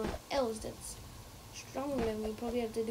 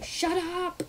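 shouting at chat; 6 semitones higher, 4 dB louder than usual. Real, not acted. Yelling at chat.

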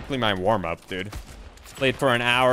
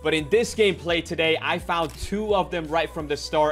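video intro; 6 semitones higher than usual. Acted. Upbeat YouTube video intro.

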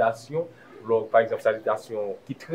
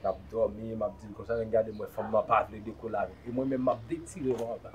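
low, soft storytelling; hushed confiding; 4 semitones lower, 11 dB quieter than usual. Acted. Man tells his friend about the night, low and hushed. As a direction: lower and soften it, as if confiding.

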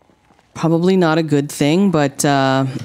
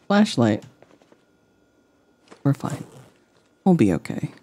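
ordinary talk, nothing marked

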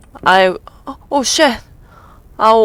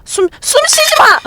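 much higher, frantic cry; panicked shriek; 3 dB louder than usual. Real, not acted. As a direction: shriek it like everything's collapsing. Shrieking at game character.

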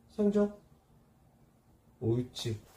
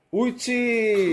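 high, louder excited pet praise; squeaky praising a dog; 7 semitones higher, 7 dB louder, much slower than usual. Real, not acted. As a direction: cheer the dog on. Praising dog after paw. (높고 신난 강아지 칭찬)

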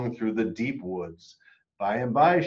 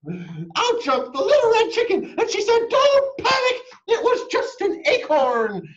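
very high, loud excited chicken; bursting in; 8 dB louder than usual. Acted. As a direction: leap in shouting reassurance. Chicken bursts in a third time.

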